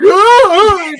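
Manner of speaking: high, loud "Ah!"; imitated yell. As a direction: yell out a high, startled-style reaction. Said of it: "I was like".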